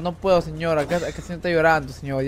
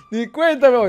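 laughing; 6 semitones higher, 5 dB louder, slower than usual. Real, not acted. Laughs at not noticing.

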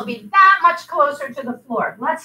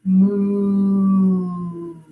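low drawn-out cow moo; soft moo; 7 semitones lower than usual. Pretend. Teacher leading kids back to cow pose, soft low moo. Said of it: "your cow".

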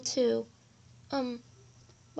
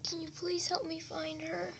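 quiet tearful plea; 2 semitones higher, 10 dB quieter than usual. Acted. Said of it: "Are you crying?".

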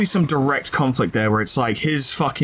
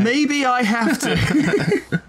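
laughing; 10 semitones higher, much slower than usual. Real, not acted. Laughs while answering his friend.

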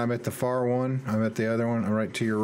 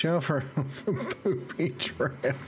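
words broken by laughter; laughing; 4 semitones higher, slower than usual. Real, not acted. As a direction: laugh through the line. Laughs while teasing about traps.

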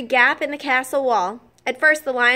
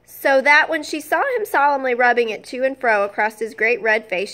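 usual speaking voice

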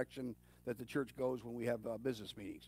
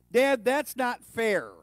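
mock child's protest, higher, louder and drawn out; complaining kid; 8 semitones higher, 10 dB louder, much slower than usual. Kids objecting to their dad, loud drawn-out complaint. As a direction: protest slowly and loudly like a put-out kid. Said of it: "when they say".

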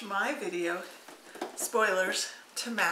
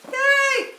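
high, squealed yay; excited squeal; 10 dB louder than usual. Real, not acted. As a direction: squeal it with delight. Squeal of delight at gift.

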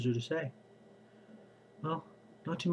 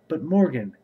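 raised protest; 4 semitones higher, 8 dB louder than usual. Acted. Farmer objects to the prince.

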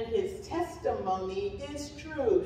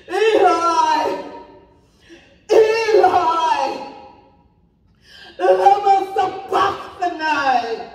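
anguished shout to the heavens, raised high; loud cry; 6 semitones higher, 13 dB louder, slower than usual. A narrator's reading. Jesus crying out to God in the afternoon darkness, loud cry. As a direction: cry it out at full volume, long and drawn out. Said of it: "Jesus cried out in a loud voice".

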